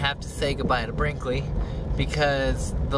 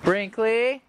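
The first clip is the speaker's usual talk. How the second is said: calling the dog; 13 semitones higher, much slower than usual. Real, not acted. Searching yard calling the dog.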